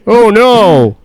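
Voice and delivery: high, loud mock Homer cry; hammed-up cartoon-dad whine; Homer Simpson impression. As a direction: yelp it like Homer. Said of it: "a bunch of Homer Simpsons".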